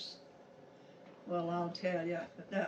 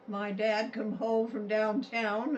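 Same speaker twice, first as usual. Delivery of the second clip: ordinary delivery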